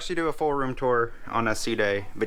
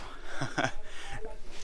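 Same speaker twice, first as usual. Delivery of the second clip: giggle; 2 semitones higher, 6 dB quieter than usual. Real, not acted. First look at cruise cabin.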